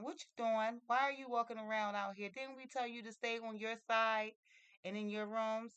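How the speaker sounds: acted-out scolding, high and loud. Housekeeper catches Mary wandering out of bounds, scolding. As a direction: rattle off accusing questions as a stern housekeeper.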